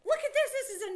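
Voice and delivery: high, loud and eager; excited discovery. Real, not acted. Teacher unveiling a new word card to a child, high excited. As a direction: show the card like a surprise.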